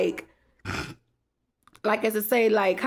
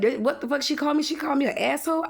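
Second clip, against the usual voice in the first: quick, higher mock indignant man; mock outrage; 6 semitones higher, faster than usual. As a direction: fire off his outraged question fast. Man angrily quoted in retold argument.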